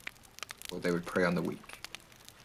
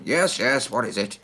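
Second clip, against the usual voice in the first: hurried wizard, higher and louder; Vador; 5 semitones higher, 8 dB louder than usual. Acted. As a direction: answer the call as you come running over. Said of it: "calls Vador, comes running over".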